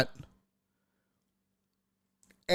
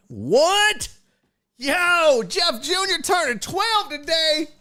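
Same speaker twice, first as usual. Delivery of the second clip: excited shout-out; 13 semitones higher, slower than usual. Real, not acted. Host shouting out a viewer's brother's birthday, excited shout.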